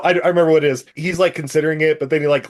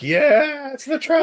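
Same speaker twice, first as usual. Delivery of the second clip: high-pitched gushing over a truck; mock excitement; 13 semitones higher, slower than usual. Podcaster greets the hero's truck, high mock excitement. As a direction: squeal it in mock awe.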